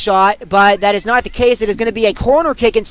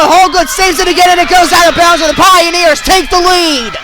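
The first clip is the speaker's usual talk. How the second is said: breathless rally call; 5 semitones higher than usual. Real, not acted. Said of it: "I can catch my breath again".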